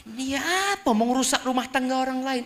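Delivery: high-pitched mock indignation; gossiping critics. People today accusing a widow of wrecking homes, high indignant voice. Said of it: "Apa kata orang sekarang?".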